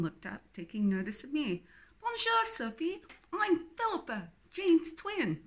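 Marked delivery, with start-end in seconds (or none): 2.0-5.4 put-on bright, much higher English-accented greeting; English accent, friendly and much higher. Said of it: "her English accent strong".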